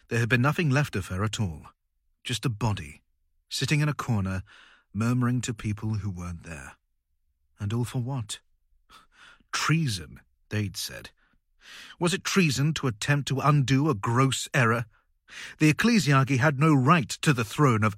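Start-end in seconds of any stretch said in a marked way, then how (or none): none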